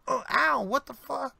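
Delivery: high yelp of pain then a confused curse; pained surprise. Acted. Bystander struck by thrown pipe yelps in surprise. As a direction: yelp as the pipe hits you. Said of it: "you just hear somebody behind him go".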